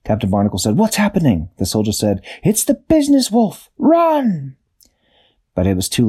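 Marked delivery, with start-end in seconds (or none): 0.8-1.5 alarmed question. A narrator's reading. Captain alarmed by chaos. 2.4-4.5 panicked warning. A narrator's reading. Soldier warns of the wolf.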